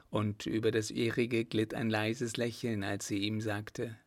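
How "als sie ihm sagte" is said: ordinary delivery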